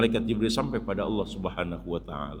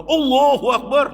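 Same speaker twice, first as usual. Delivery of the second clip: high, loud Allahu Akbar; mock loud cry; 13 semitones higher, 9 dB louder than usual. Shown as a demonstration. Preacher mocking voicing zikir aloud, high and loud. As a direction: blurt it out with too much zeal. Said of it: "jangan disuarakan".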